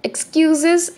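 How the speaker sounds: mocking rise on "Excuses"; "Excuses" sung up, teasing; taunting. A narrator's reading. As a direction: rival mocking the late team, taunting rise. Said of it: "Melanie taunted".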